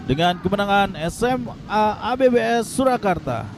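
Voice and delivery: ordinary talk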